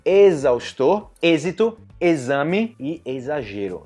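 "Exaustor", "êxito", "exame" and "exagero" said slowly, X as z. Shown as a demonstration. Teacher models X as z, slowly. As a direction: stretch "exame" with a z sound. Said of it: "Você usa também em português".

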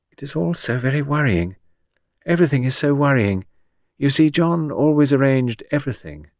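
helplessly worried widow; fretful Gerda. Acted.

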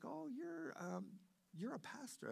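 high, softened voice, imitating a surprised neighbor; hesitant, high realization; acted dawning recognition. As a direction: piece it together in a high voice. Voices neighbor recognizing him as pastor.

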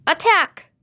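high, loud command to attack; battle shout. A narrator's reading. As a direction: shout it like a child playing war. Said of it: "The shout came from behind".

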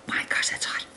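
hushed gasp at the heat; whispered exclaim. Real, not acted. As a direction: breathe it out under your breath. Handling hot squash, whispering.

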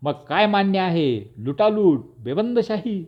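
high, outraged outburst; exasperated, shrill-pitched yelling; raising a ruckus. A narrator's reading. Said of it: "त्याने चावडी डोकीवर घेतली".

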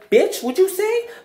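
high-pitched, incredulous challenge; sassy high-pitched clapback; confrontational comeback. Shown as a demonstration. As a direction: snap back at a bully, voice shooting way up.

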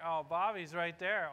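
ordinary talk

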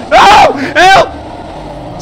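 very high, loud scream then a breathy cry for help; terrified scream. Real, not acted. Gamer screaming as creature lunges, terrified. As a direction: scream for your life.

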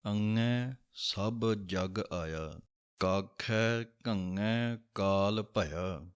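slow, careful Gurbani line reading; slow recitation. Shown as a demonstration. Teacher reciting a scripture line slowly. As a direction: recite each word slowly and clearly. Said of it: "ਸੋ ਇਸ ਤਰ੍ਹਾਂ ਉਹਦਾ ਉਚਾਰਨ ਹੈ".

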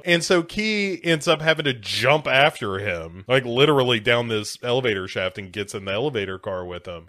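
normal talking voice

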